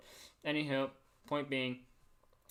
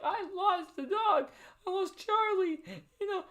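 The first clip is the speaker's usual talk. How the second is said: imitating crying mom; 3 dB louder than usual. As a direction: wail it as the heartbroken mom. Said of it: "This is how she was".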